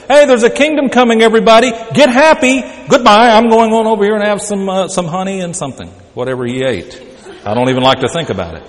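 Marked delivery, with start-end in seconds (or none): 0.1-5.9 louder, higher, breezy mock announcement; mock cheery preacher. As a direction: ham up a careless, happy-go-lucky John. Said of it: "John doesn't step out and say".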